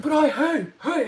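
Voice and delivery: loud, high mock objection; flustered protest. Giant objecting to her demands, flustered protest. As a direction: sputter a hammed-up protest.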